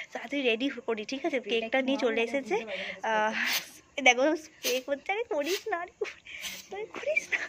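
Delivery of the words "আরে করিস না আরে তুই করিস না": squealing protest. Real, not acted. Friends spraying at her.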